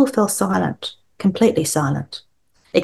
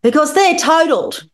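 a high, louder gleeful blurt; drunk triumph; 10 semitones higher, 4 dB louder than usual. Acted. Drunk dad mocks the teetotalers, high gleeful blurt. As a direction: land the mangled word proudly.

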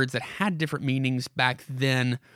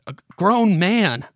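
disgusted gagging; 7 semitones higher, 7 dB louder, slower than usual. Acted. Lockwood is gagging.

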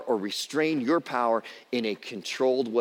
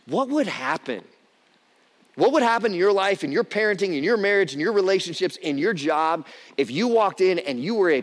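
raised, louder preacher's build-up; rally the congregation with rising force; preaching; 6 semitones higher, 3 dB louder than usual. Acted.

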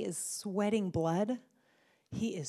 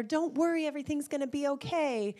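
higher, brisk comforting voice; pat-on-the-back reassurance, pitched up and quick; reassuring an older kid; 8 semitones higher, 4 dB louder than usual. Retold or imitated. Parent brushing off a teen's worry, high and brisk. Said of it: "when they get older".

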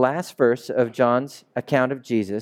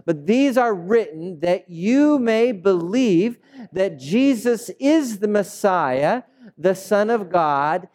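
higher, slow, solemn reading voice; scripture reading; 7 semitones higher, slower than usual. Acted. Preacher reading John's last verse to the congregation. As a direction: read the verse out slowly for all.

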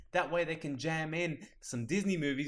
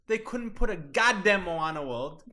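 mock angry; 5 semitones higher, 4 dB louder than usual. Real, not acted. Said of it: "I'm so mad".

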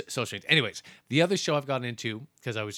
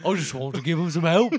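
loud, slightly higher put-on voice with a laugh in it; 5 semitones higher, 7 dB louder than usual. Podcaster mocking a rock star for his co-host, hammed-up impression. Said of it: "It's my [name] impression".

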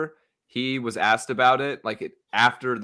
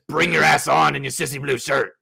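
louder, challenging coach voice; gruff coach comeback; 2 semitones higher, 4 dB louder than usual. Retold or imitated. Coach shouting back at a heckler, challenging. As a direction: call him down, daring him. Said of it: "he said".

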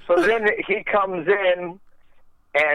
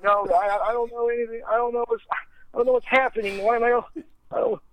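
freaked out; 7 semitones higher, faster than usual. Retold or imitated. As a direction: stammer it like you're scared of a raid. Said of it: "getting even more paranoid and freaked out".

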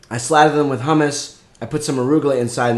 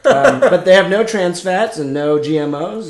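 laughing; 5 semitones higher, 3 dB louder than usual. Real, not acted. Admitting snacks are unhealthy.

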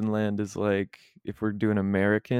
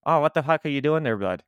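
Scotian accent; 6 semitones higher, 3 dB louder than usual. Shown as a demonstration. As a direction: Scotian accent with an Irish lilt. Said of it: "a little bit of that Irish kinda thing".

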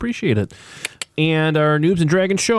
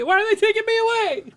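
mock wail; faster than usual. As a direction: let it trail off in fake despair. Acts being taken away.

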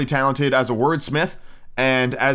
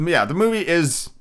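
ordinary talk

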